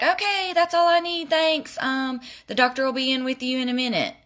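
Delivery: imitating the nurse in a high, chirpy voice; high, rushed brush-off; mock nurse voice. To the patient, the nurse wraps up in a high mock voice.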